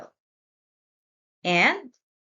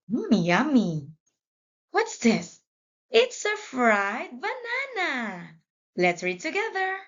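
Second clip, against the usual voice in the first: usual speaking voice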